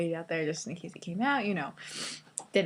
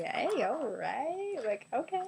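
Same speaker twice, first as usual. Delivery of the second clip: high, slow, coy and pleased; a stretched-out, high, flirty okay after a compliment; flattered; 6 semitones higher, slower than usual. Retold or imitated. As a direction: drawl it out, playfully flattered.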